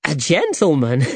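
laughing in disbelief. A narrator's reading. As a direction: chuckle at the very idea. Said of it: "laughed Alejandro".

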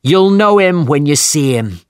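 rally the crew like a grim captain; commanding captain. A narrator's reading. Ahab speaks to crew.

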